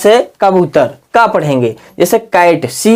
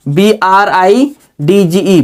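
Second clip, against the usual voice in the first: slow, letter by letter; slow spelling out; slower than usual. Shown as a demonstration. Teacher spelling bridge aloud, slow and deliberate. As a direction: spell it out clearly for the class. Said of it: "ये bridge".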